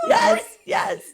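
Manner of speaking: very high, loud laughing yes; laughing scream. Real, not acted. Retelling Mare's line, shrieking with laughter. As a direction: shriek it through tears of laughter.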